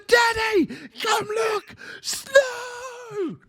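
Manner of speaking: put-on very high, thrilled child's call; excited little son. Father imitates his son running up shouting about paper snow, very high and excited. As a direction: call it out like a kid racing to the door. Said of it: "He's like".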